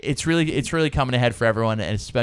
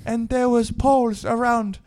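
high, slow put-on voice for his quote; Asuelu impression; 12 semitones higher, slower than usual. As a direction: quote him in a high, childlike voice. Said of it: "He said".

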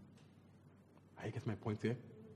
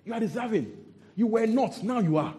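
emphatic declaration; 7 semitones higher, 4 dB louder than usual. Real, not acted. Preacher's emphatic climax.

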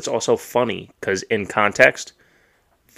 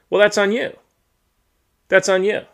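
retort; 11 semitones higher than usual. Real, not acted. Retorting to a tweet.

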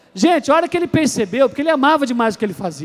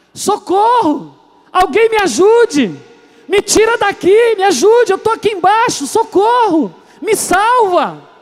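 much higher, louder, slow drawn-out screams; desperate cries for help; 8 semitones higher, 5 dB louder, slower than usual. Acted. Gravedigger hearing footsteps yells desperately from the grave. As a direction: wail for rescue as if your life depends on it. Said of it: "E começou a gritar".

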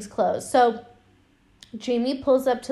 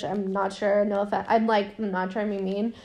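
ordinary delivery

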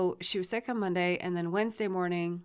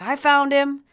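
raised, higher-pitched phone announcement; loud relief; 7 semitones higher, 10 dB louder, slower than usual. Retold or imitated. Parent telling Sully on the phone, loud relief. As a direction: call it out urgently down the line.

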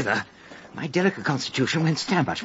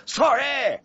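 higher, louder quick yell of sorry; yelled apology; 10 semitones higher, 4 dB louder than usual. Retold or imitated. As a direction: call it out through the door. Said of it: "I yelled".

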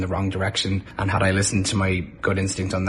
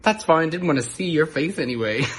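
sassy retort; 6 semitones higher than usual. Retold or imitated. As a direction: brush him off. Retorting sassily to angry host.

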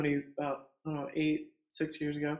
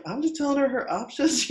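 high-pitched protest; mock-innocent defense; 9 semitones higher, 3 dB louder than usual. Doula defending herself to the midwife, mock-innocent. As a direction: plead innocence, acting out your own comeback.

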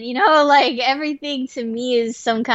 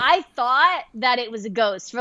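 ordinary delivery

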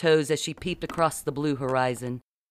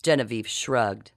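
plain reading voice

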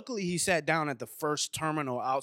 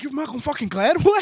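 loud, high, incredulous question; starstruck disbelief; 9 semitones higher, 10 dB louder than usual. Retold or imitated. Fan meeting Malcolm Gladwell at the airport, starstruck. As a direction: blurt it out in astonished excitement.

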